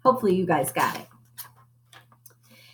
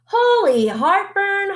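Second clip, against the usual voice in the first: high, slow startled holler; high, drawn-out alarmed holler; 11 semitones higher, 3 dB louder, slower than usual. A narrator's reading. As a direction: cry out in alarm. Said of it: "hollered Scipio".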